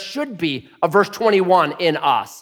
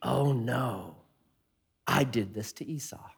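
guilty realization; 5 semitones lower, 12 dB quieter than usual. Retold or imitated. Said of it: "He's reminded".

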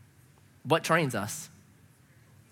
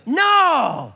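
higher, louder roar of a reckless driver; wild shout; 8 semitones higher, 5 dB louder than usual. Retold or imitated. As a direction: roar it like peeling out.

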